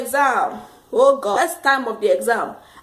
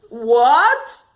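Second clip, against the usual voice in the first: louder, incredulous what; shocked what; 6 dB louder than usual. Retold or imitated. Her reaction when told the exam ends, shocked. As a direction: blurt it in disbelief.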